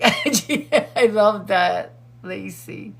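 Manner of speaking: laughing as you say it; drawn out through real laughter; amused, words breaking into a laugh. Real, not acted. Laughing at the pet's nickname.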